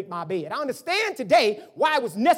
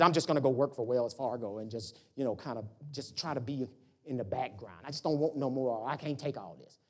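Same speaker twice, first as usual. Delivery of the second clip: weary brother quoted; 10 semitones lower, 8 dB quieter than usual. Retold or imitated. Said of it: "That's what he said".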